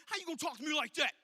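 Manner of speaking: quick, indignant mock outrage; mock offended. Preacher mimicking a prideful person who got offended, indignant. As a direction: snap back like someone insulted. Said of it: "When you get upset".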